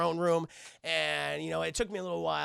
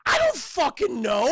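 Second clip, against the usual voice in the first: loud, high, drawn-out acted yell; exasperated yell; 7 semitones higher, 9 dB louder than usual. Aang has no answer for the king, exasperated yell. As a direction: blurt it at the top of your voice.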